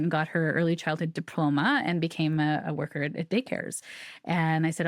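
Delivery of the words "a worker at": ordinary delivery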